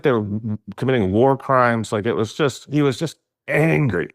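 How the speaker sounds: usual speaking voice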